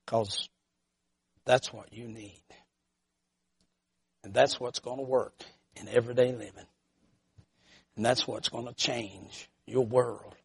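low, very soft, spaced phrases; hushed preaching. Real, not acted. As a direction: bring it down to a solemn, quiet appeal.

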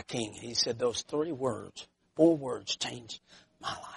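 low, soft, reverent retelling; hushed testimony. Retold or imitated. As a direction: sink into a quiet, humbled confession. Quiet close of testimony.

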